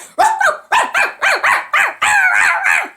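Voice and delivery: high, loud yapping barks and growls; imitated dog barking. Little pit bull attacking the villain, frantic barking. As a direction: bark furiously like a small dog.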